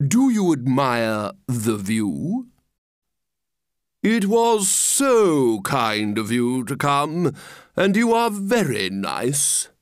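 slow, unctuous swooping flattery; pompous walrus. Acted. Walrus flatters the oysters he will eat, pompously. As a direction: draw out the compliments grandly. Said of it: "the walrus said".

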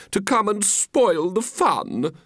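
high-pitched indignant lady; offended lady. Acted. She complains about spoiled fun, high and offended. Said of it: "she said".

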